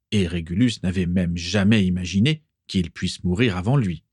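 ordinary delivery, nothing marked

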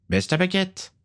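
ordre bref, voix très aiguë; ordre ferme. A narrator's reading. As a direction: commande au garçon, rythme normal. Vampire donne un ordre ferme. (vampire autoritaire, ton haut et ferme)

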